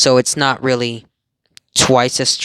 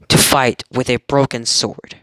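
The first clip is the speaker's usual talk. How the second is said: usual speaking voice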